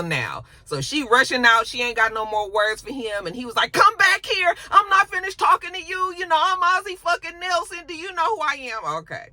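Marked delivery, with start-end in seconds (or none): 3.7-9.0 high, loud calling after someone; hammed-up outraged demand, easing in volume; mock angry yell. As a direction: imitate a man yelling at someone walking off. Acted shouting after fleeing woman.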